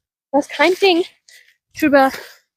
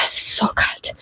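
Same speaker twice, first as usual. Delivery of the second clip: whimpering whisper; 8 dB quieter than usual. Real, not acted. Freezing on cold walk.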